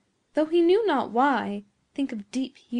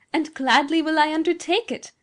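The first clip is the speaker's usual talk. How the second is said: put-on higher, louder young lady's voice; rich youthful cry; 6 semitones higher, 6 dB louder than usual. A narrator's reading. Daughter gladly accepting her father's charge, rich youthful cry. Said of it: "cried again the rich tones of the young lady".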